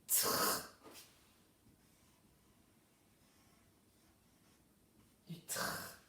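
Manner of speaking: "tr" with the r rasped and held, no vowel; isolated blend. Shown as a demonstration. Teacher breaks down trois for pupils, isolating the blend. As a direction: hold the r of "tr" like a long scrape. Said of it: "pour écrire trois, on a besoin".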